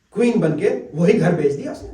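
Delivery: ordinary delivery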